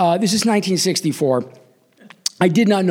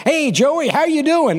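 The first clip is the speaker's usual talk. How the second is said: high, swooping baseball announcer voice; Mel Allen; 9 semitones higher than usual. Retold or imitated. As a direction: imitate an old-time sportscaster's greeting. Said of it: "says in that baseball voice".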